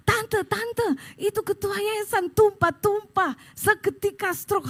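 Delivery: high-pitched put-on voice, urgent news; imitating a panicked phone call; alarmed caller. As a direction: break bad news like a frantic caller. Phone caller reports sudden stroke.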